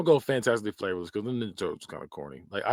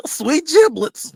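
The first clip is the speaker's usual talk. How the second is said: much higher, louder, slow cartoon goof; Goofy voice; 9 dB louder, much slower than usual. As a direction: ham it up like Goofy. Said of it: "make it sound like Goofy".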